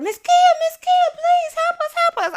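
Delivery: put-on very high, loud pleading kid voice; little girls begging. Little campers begging Miss Kia for help, high and pleading. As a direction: squeak out a frantic plea like a small girl. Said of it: "They come knocking on the door".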